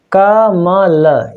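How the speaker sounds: कमल drawn out very slowly, syllable by syllable. Shown as a demonstration. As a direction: spell out कमल slowly for the class. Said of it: "हम इसको जो है विखंडन करना सीखेंगे". (कमल को धीरे-धीरे अक्षर तोड़कर)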